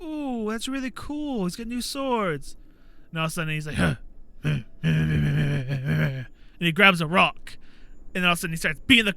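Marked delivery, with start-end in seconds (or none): 0.0-2.6 slow, high-pitched admiring ooh; retold awe. Retells own delight at new swords. 3.8-6.2 rapid run of throaty huhs; imitated manic grunting. Imitates Inosuke before grabbing a rock.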